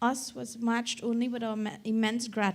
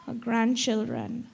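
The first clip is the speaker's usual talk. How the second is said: normal talking voice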